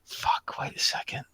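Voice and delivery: whispered curse to himself. Real, not acted. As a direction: breathe it out as a private whisper. GM catches his own mistake.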